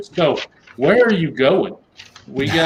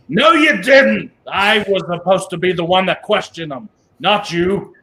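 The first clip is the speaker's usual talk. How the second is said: gravelly sly hobgoblin; 5 semitones higher than usual. Acted. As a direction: snap back as the angry hobgoblin. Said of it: "the hobgoblin says".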